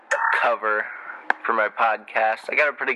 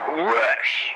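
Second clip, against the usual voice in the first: whoosh; 9 semitones higher than usual. Retold or imitated. Imitating flying shark.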